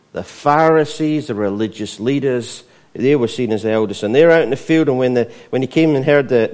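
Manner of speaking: full-force sermon delivery; fiery preaching. Acted. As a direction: preach it out to the whole room.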